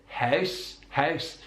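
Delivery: "house" with a closed, short Belfast ow; Belfast diphthong. Shown as a demonstration. Teacher repeating the example, closed diphthong. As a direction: tighten the vowel in "house", Northern Irish style. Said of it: "slightly more closed, shorter sound".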